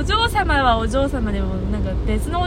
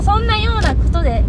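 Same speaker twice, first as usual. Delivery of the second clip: usual speaking voice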